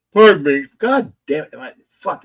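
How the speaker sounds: talking through a yawn; words stretched by a yawn. Real, not acted. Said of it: "I yawn".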